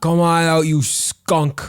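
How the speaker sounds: cop shout. Retold or imitated. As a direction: bark it like a cop. Repeats cop yell louder.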